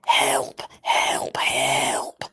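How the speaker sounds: whispered mock cries for help; Alec Baldwin. Imitating the last narrator's scream, whispered helps. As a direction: whisper each help separately, all breath. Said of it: "Alec Baldwin".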